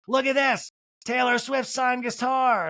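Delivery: high-pitched excited impersonation; Kyle. Retold or imitated. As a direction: call it out high, with drawn-out words. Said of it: "And Kyle's like".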